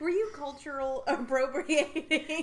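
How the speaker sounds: slow, loud laughing tease. Real, not acted. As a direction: tease while laughing hard. Teasing through laughter.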